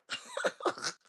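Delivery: shrieking high laugh with a cry of jeez; high laughter. Real, not acted. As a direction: crack up at your own rant. Laughing at own rant.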